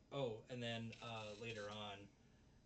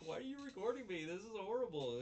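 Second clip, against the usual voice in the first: much higher, fast, appalled; shrill outrage; 11 semitones higher, 5 dB louder than usual. Retold or imitated. Girl appalled at being filmed, high and fast. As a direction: squeal it in horror. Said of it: "She's like".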